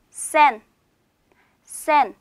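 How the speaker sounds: 센 said as one clear syllable, "sen"; teacher's careful, isolated 센; pronunciation model. Shown as a demonstration. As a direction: model Turkish 센 for learners, set apart by pauses. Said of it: "요렇게 읽고요".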